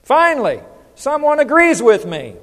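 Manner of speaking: high, loud punchline of vindication; hammed-up relief, pitched high and loud; mock triumph. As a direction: crow it as the long-awaited vindication. Punchline of quoted parent's joke.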